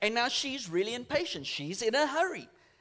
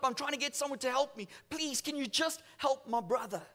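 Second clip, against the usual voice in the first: higher, urgent pleading of a young boy, retold; acted desperate pleading; 3 semitones higher than usual. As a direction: plead for help in a raised pitch. Tearful boy pleading for his brother.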